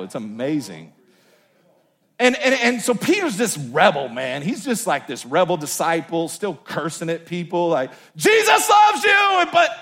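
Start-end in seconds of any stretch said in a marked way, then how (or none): 0.0-0.9 softer, lower "amazing" in quiet wonder; hushed awe. Shown as a demonstration. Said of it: "Look at the Bible". 8.2-9.7 high, loud, drawn-out sing-song blessing; mock blessing. Rebel Peter mock-blessing people.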